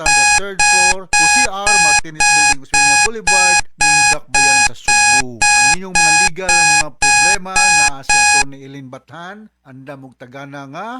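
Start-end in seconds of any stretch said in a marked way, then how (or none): 0.0-8.6 much louder radio announcing; host announcing the office address; loud announcement. Real, not acted. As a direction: boom it out like on air.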